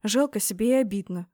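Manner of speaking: plain reading voice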